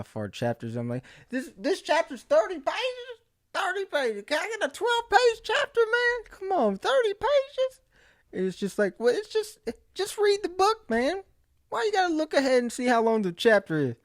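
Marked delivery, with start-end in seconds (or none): 1.3-7.8 squeaky, much higher voice, whining about page counts; high whiny complaint. Retold or imitated. Reader complaining to himself about a long chapter, squeaky whine. 9.0-13.0 high, pinched voice telling himself off; squeaky self-scolding. Retold or imitated. Reader nagging himself to just read, high squeaky nag.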